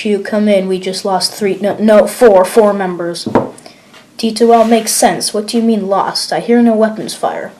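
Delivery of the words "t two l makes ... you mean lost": usual speaking voice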